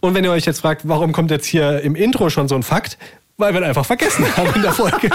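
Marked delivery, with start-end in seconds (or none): 3.4-5.2 fast, laughing confession. Real, not acted. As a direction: laugh through the words. Admits forgetting, laughs.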